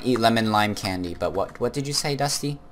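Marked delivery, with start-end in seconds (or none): none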